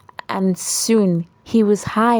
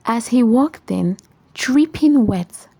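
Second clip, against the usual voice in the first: ordinary delivery, nothing marked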